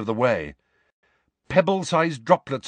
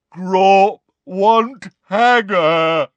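slow, loud, raised giant bellow; roaring giant; 8 semitones higher, 9 dB louder, much slower than usual. A narrator's reading. Giant insisting on his friend, slow roar. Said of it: "roared Grawp".

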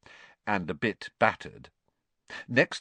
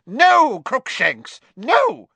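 shrill, much higher and louder cry; 10 dB louder, much slower than usual. Acted. Witch cries out at the leaping cat, much higher and louder. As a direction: shriek to stop the cat. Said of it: "cried the witch".

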